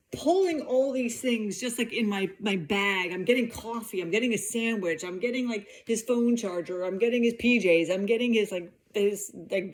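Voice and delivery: ordinary delivery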